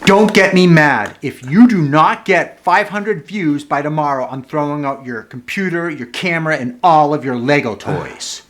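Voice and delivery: hammed-up furious threat to a kid; threatening parent. Parent acting out a threat to the kids, mock angry and loud. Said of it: "the threat tool".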